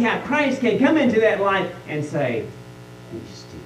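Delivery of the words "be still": barely breathed, whispered command; calm whisper. Acted. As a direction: whisper it gently to a troubled heart. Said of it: "in just a very calm voice".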